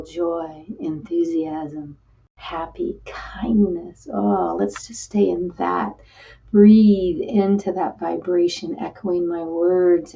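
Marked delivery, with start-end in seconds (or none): 0.0-4.1 soft breathy whisper; hushed healing whisper. Acted. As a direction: let it fall to a whisper. Whispered list in meditation.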